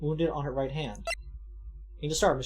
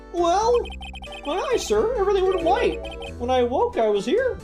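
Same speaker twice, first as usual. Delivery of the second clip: high, earnest rookie cop voice; eager young patrolman; 13 semitones higher, 4 dB louder than usual. Acted. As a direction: play the nervous young officer, pitched up. Voices patrolman character in game.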